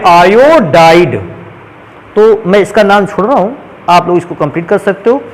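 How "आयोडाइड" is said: आयोडाइड stretched very slowly, each syllable spelled out; drawn-out ending. Shown as a demonstration. Teacher dictating the iodide name, very slow and deliberate. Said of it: "iodine को क्या पढ़ते".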